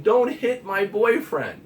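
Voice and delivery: high, loud, drawn-out yell; mock yell. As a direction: voice her frantic protest. Girl's quoted scream retold.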